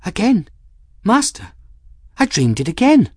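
high-pitched, upset Folly; thin voice, pitched far up; small distressed voice. A narrator's reading. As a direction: confide a recurring nightmare, high and upset. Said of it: "her voice came out very quietly".

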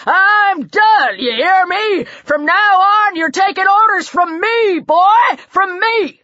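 high, forceful shouted orders; angry bellow. A narrator's reading. Man seizing command from a boy, bellowing. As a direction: bellow it like you are taking command. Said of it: "Jarvis bellowed".

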